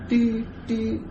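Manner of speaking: high beeping voice mimicking elevator chimes; imitated elevator beeps. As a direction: beep like the elevator. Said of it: "Y'all probably know the elevator sounds by now".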